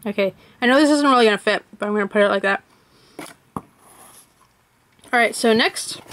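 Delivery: ordinary talk, nothing marked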